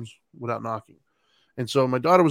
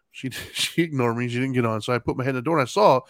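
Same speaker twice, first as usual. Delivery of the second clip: ordinary delivery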